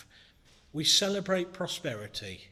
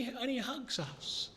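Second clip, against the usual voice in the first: slow, much softer, tender close; 7 semitones higher, 7 dB quieter, slower than usual. Real, not acted. Preacher telling grieving listeners God holds them, tender and soft. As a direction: let it land gently, like an embrace.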